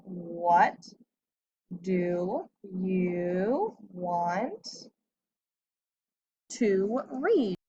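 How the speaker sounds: very slow, every word drawn out as if written on the board; said very slowly while writing. Shown as a demonstration. Teacher writing the sentence out for the class, very slow and drawn out. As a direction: stretch "What do you want to read?" out word by word, pausing as the pen moves. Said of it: "So I need to do my capital W".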